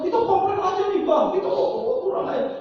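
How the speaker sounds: high, mildly annoyed complaint; a hint of an indignant family member. Family urges the imam to complain, lightly voiced indignation. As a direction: grumble to the imam about the leak.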